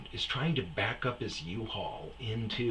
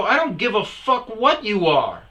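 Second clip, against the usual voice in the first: higher, louder furious retort; angry yell; 11 semitones higher, 10 dB louder than usual. Retold or imitated. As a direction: shut him down hard. Yelling back at driver.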